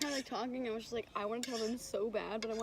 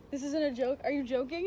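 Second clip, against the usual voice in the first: louder, higher incredulous questioning; insistent disbelief; 4 semitones higher, 6 dB louder than usual. Retold or imitated. Woman retelling how she questioned her fiancé, incredulous. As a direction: press for a straight answer in disbelief. Retells pressing him on proposal.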